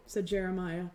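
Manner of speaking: plain reading voice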